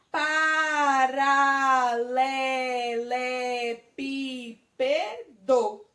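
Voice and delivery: very slow syllables. Shown as a demonstration. Teacher spells out the long word for kids, very slow. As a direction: drag out each syllable of Paralelepípedo. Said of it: "Vamos dizer aquela palavra".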